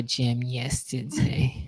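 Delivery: very slow breathy whisper, jam yesterday; whispered catchphrase. Pretend. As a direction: fade it out in a whisper.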